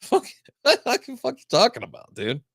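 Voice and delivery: laughing disbelief. Retold or imitated. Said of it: "I'm like".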